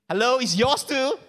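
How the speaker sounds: mock retort. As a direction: snap back at the spouse. Spouse retorts in quarrel.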